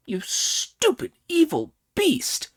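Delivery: high, shrieking monkey insult; railing. Acted. Monkey railing at Pig, high angry shriek. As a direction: spit it at him furiously. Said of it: "railed Monkey".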